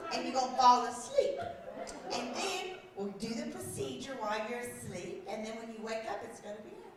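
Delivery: soft, low imitation of a reassuring nurse; soothing nurse. Nurse explains procedure to patient, soothing imitation. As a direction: explain it gently and quietly, as to a patient.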